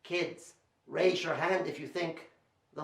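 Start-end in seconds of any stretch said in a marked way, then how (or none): none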